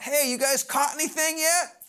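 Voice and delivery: acted-out holler, pitched well up; yelling out. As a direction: call out across the water. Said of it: "the guy yells out".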